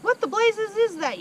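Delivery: shocked yell. Acted. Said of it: "yelled the Trunchbull".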